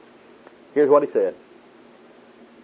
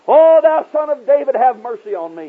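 high, loud pleading cry; 13 semitones higher, 6 dB louder than usual. Retold or imitated. Blind beggar pleading to Jesus from the roadside, crying out. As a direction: cry out for mercy, voice pitched high. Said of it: "he cried out".

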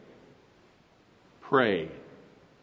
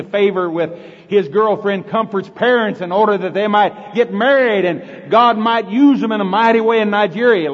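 louder, earnest demo prayer; specific prayer; 5 semitones higher, 7 dB louder than usual. Shown as a demonstration. Preacher demonstrates a specific prayer for a friend, loud and fervent. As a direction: pray it out with fervour. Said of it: "We need to pray specifically".

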